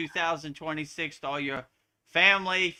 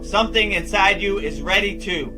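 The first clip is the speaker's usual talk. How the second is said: upbeat promo pitch, higher and louder; ad read; 4 semitones higher, 12 dB louder than usual. Acted. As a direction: sell it like a commercial voiceover. Promo read over music.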